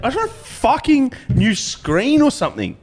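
high, fed-up rant at the staff; exasperated rant. Retold or imitated. Telling the Apple genius he does nothing, exasperated and high. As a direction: vent it, pitched well up.

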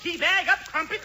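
high British Santa, calling his reindeer; rousing English-accented calls to the team; English Santa Claus. Acted. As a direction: send up Santa as a dotty Englishman. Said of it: "English Santa Claus".

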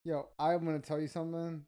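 usual speaking voice

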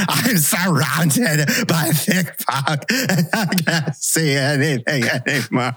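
high, drawn-out hysterical wail; Ron Burgundy yelling. Acted. Co-host acting Ron Burgundy in the phone booth, yelling hysterically. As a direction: wail it slowly, as if trapped and desperate. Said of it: "pretend you're Ron Burgundy".

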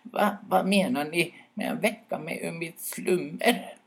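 high, put-out owl voice; grumpy at being woken; irritated owl. Acted. Woken owl grumbles at the birch brothers. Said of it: "frågade irriterat".

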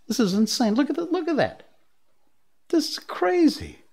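rising, excited exclamations; high-pitched wow; amazed. Real, not acted. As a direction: marvel at the stunt. Amazed watching a bike courier video.

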